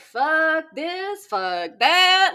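loud, fed-up cursing; angry swearing. Retold or imitated. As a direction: vent loudly after work. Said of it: "I'm like".

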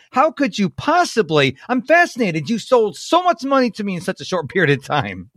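high, mock-incredulous retelling; imitated amused disbelief, pitched well up; humorous fascination. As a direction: play astonished fascination for laughs. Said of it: "He brought humor into it".

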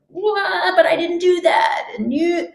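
high, indignant protest; mock outraged denial; retold inner outrage. Therapy session, inner protest at family, mock outraged. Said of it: "how raging I was inside".

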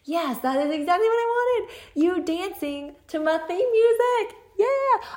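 giddy high-pitched joy at the listener's message; thrilled squeal. Retold or imitated. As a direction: squeal like you just found the perfect song. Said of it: "I was like".

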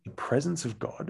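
mildly acted, hushed disbelief; puzzled echo. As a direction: echo it back as if baffled. Puzzled echo of pastor's answer.

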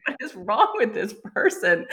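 a laughing, mock-baffled question; giggling disbelief. Real, not acted. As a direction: laughs as she asks it again. Laughing at the memory.

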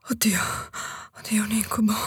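whispered. Acted. Horrified aside.

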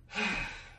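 big audible exhale; long demonstrated sigh of relief; relieved sigh. Shown as a demonstration. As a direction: breathe out and let it all go. Shows letting go amid storms.

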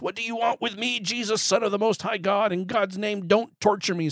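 high-pitched acted plea; pleading demon shout. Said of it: "He shouted at the top of his voice".

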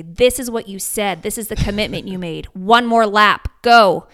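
firm coaching yell. Retold or imitated. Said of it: "terrible yelling at you".

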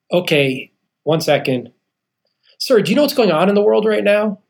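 a hint of the polite phone agent; the bank clerk checking his account; bank lady voice. Bank woman questions him on phone.

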